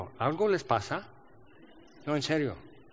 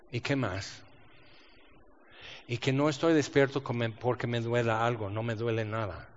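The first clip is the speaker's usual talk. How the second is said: soft answer to God; 3 semitones lower, 6 dB quieter than usual. Retold or imitated. Quiet answer to God at night.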